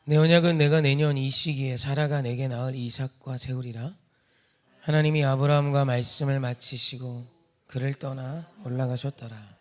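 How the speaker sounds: measured, projected Bible reading; formal recitation, starting loud and settling; scripture reading. A narrator's reading. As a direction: read the verse out to a congregation. Reads alternate verse aloud.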